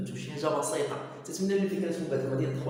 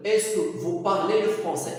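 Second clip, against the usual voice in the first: very slow, louder "Est-ce que vous parlez le français?"; 2 semitones higher, 6 dB louder, much slower than usual. Shown as a demonstration. Teacher drilling the est-ce que question for the class. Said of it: "كنقدر نقول لك".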